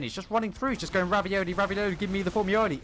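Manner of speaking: high-pitched, hammed-up hype; playful excitement. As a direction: rattle it off high like a silly battle chant. Playful chant about player's rampage.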